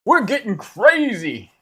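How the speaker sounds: high, loud, drawn-out sales pitch; hammed-up wild sales announcer; mock deal-maker. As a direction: holler it like an over-the-top salesman. Mock sales pitch while painting.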